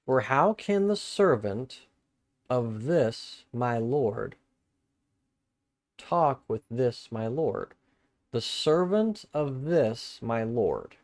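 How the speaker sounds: slow, deliberate reread of the verse; slow careful rereading. Shown as a demonstration. Preacher rereading the verse slowly for his listeners. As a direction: read it again slowly, weighing each word. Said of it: "I want to read this again".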